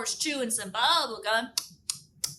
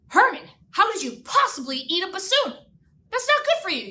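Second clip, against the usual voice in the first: shocked; 7 semitones higher, 4 dB louder than usual. Retold or imitated. Narrator scolds friend.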